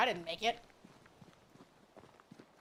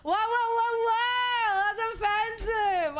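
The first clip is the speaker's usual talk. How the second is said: higher and louder, hammed-up whoa, whoa, then slow; mock outrage; 11 semitones higher, 4 dB louder, much slower than usual. Streamer faking outrage at chat's joke, loud mock protest. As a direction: play the offended streamer for laughs.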